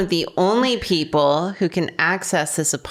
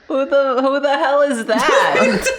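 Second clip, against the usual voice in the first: higher, laughing disbelief; laughing shock; 7 semitones higher than usual. Real, not acted. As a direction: blurt out in amused shock. Surprised laughing outburst.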